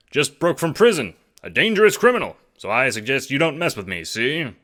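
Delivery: high, loud mock gangster, see; tough-guy threat. A narrator's reading. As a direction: play the hard-boiled crook.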